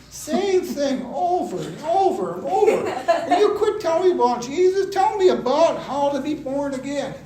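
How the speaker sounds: loud, high-pitched exasperated outburst; yelled retold complaint; furious, exasperated. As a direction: rant at the preachers, fed up. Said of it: "I'm just furious".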